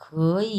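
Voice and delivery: "খই" joined as second plus third tone; linked "খই" with "খ" converted to second tone; tone change demo. Shown as a demonstration. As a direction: model "খই" in one go. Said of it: "আমরা উচ্চারণ করবো".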